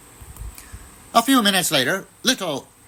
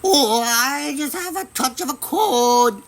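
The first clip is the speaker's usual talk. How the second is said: much higher, squeaky put-on voice; squeaky wolf; 12 semitones higher than usual. A narrator's reading. Wolf pretends to be grandmother with a cold, squeaking high. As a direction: squeak it like a wolf faking a sick granny. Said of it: "squeaked the wolf".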